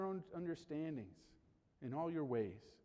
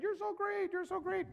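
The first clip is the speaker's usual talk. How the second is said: much higher sing-song cheer of you're so great; mock cheering posse. Little posse running behind you, high mock cheering. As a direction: squeal it like a fan club waving a sign. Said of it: "their little sign saying".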